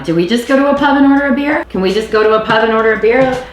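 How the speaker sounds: high, quick, hopeful question; eager hopeful question. Pretend. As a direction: pitch it up like a wishful tourist. Imagining asking at a pub.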